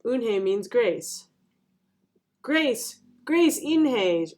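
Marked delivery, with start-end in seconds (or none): 2.4-4.3 high, drawn-out exclamation; mild shout. A narrator's reading. Ralph trying the new name out, a mild shout. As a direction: call it out slowly in a raised pitch. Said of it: "shouted Ralph".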